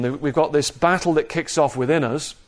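normal talking voice

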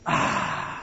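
unvoiced contented sigh; satisfied breathy ahh. Shown as a demonstration. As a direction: let out a long relieved ahh. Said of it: "Let's say your ahs".

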